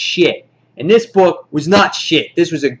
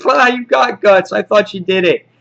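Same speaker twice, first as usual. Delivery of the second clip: raised, lively mocking exclamation; higher, lightly acted taunt; mock gloating villain; 6 semitones higher, 3 dB louder than usual. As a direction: play a villain jeering in amazement. Villain's gloating reaction, retold.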